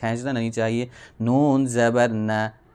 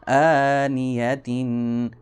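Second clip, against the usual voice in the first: آنیتن drawn out slowly, syllables joined; spell-out blending at half speed; slow joined reading; much slower than usual. Shown as a demonstration. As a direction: read آنیتن slowly as one word. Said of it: "وقفاً آ و،".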